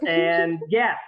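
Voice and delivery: ordinary talk, nothing marked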